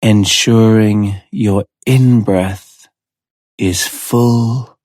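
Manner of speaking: slow, measured hypnotherapist voice with long pauses; unhurried guided-trance delivery; hypnotic relaxation induction. Real, not acted. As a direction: ease the listener down into relaxation, a few words at a time.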